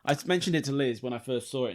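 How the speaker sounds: normal talking voice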